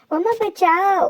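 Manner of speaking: high, urgent cry for mama; calling for help. Acted. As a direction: shout for mama to come save them. (घबराई हुई ऊँची पुकार, मम्मा बचाओ)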